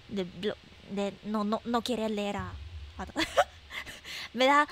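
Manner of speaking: normal talking voice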